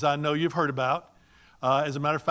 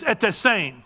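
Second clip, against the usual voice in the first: louder, higher greeting; with gusto; 5 semitones higher, 5 dB louder than usual. Shown as a demonstration. Speaker showing how Ghanaian friends greet, with gusto. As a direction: call the greeting out heartily. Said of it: "they do it with gusto".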